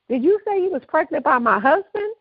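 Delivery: high-pitched incredulous question; retold shock. Wife questioning her pregnant friend about her husband, acted in disbelief. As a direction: imitate a friend who can't believe it. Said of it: "obviously shocked".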